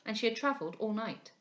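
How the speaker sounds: ordinary delivery, nothing marked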